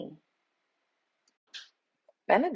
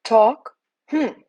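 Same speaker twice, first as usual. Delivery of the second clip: grumbling; 5 semitones higher, 8 dB louder than usual. A narrator's reading. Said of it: "grumbled Benedict".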